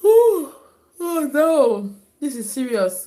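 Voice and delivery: a big high yawning "ooh"; loud yawn. Real, not acted. Streamer overcome by a yawn on air, loud and high.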